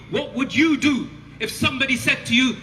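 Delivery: ordinary talk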